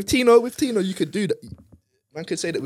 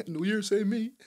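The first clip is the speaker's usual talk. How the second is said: mimicking Lamelo Ball; 7 semitones higher, slower than usual. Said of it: "He's like".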